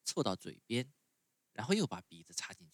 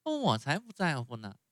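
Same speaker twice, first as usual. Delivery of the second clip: put-on higher, louder stubborn child; defiant puppet boy; 6 semitones higher, 6 dB louder than usual. A narrator's reading. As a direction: snap back defiantly. Pinocchio defiant.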